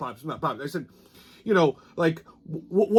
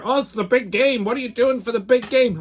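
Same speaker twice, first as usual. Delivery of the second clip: higher-pitched mock eager fan; mocking big-game fan; 5 semitones higher than usual. People hyping the big game, mock excitement. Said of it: "when people refer".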